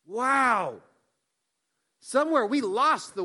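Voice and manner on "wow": put-on a delighted child's wow, held out; childlike wonder, lightly done. Shown as a demonstration. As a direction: let it ring with a kid's wonder. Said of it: "kid says".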